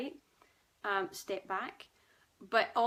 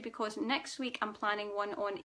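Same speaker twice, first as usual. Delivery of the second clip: ordinary delivery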